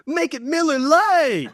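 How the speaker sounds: high, loud, drawn-out slogan yell; shouted ad slogan. Retold or imitated. Liver costume guy pulling up a beer, triumphant slogan yell. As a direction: burst into a hammed-up beer commercial shout. PSA flips into beer ad punchline.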